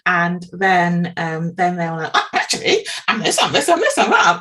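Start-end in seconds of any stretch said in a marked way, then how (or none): 2.1-4.4 high and louder, eager rush of realisation; sudden realisation. Retold or imitated. Host retells the client's eager discovery after the coach's joke, with excited delight. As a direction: play the client suddenly seeing every answer, voice jumping up.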